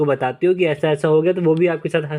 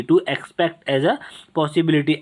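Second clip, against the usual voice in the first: "to expect as a" drawn out word by word, then "possibility"; very slow repeat; much slower than usual. Shown as a demonstration. Teacher repeats the definition very slowly for students to note. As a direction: spell out "to expect as a" very slowly for the class. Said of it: "मतलब कि to expect as a possibility".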